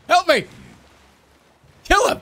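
high, loud yells for help; shouting for help mid-fight in a game; frantic shout. Real, not acted. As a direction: yell at your ally in panic. Panicked calls during a game fight.

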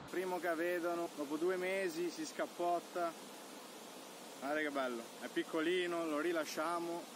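excited. Real, not acted. Excited catch, showing the fish.

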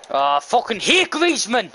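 loud, high-pitched angry curse; real exasperated outburst. Real, not acted. Gamer cursing an opposing footballer mid-match, exasperated outburst. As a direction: burst out in frustration, pitch shooting up. Cursing a player during the game.

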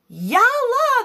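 high, loud, drawn-out storybook shout. A narrator's reading. Genie urging his flying carpet on, high shout. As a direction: shout it out on a soaring pitch. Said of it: "the genie shouted".

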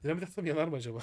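ordinary delivery

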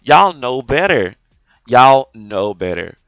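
loud, drawn-out reprimand; slow, emphatic telling-off, hitting each word hard; scolding. Real, not acted. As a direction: scold them loudly, stretching it out. Scolding others, repeated for emphasis.

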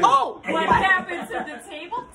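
laughing shout. Real, not acted. Table collapses, family laughing.

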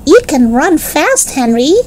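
put-on be a small boy admiring his big brother; admiring little boy.

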